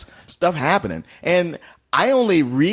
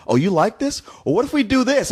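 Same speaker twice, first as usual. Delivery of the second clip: higher, teasing one-upping; mock taunting movie; 7 semitones higher than usual. The movie escalating at the viewer, mock taunting. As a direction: ham up the movie showing off. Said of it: "where it was like".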